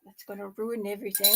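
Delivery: usual speaking voice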